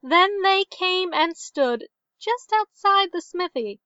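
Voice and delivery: folktale storytelling. A narrator's reading. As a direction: recite an old tale, drawing out phrase endings. Tells an old tale within the book.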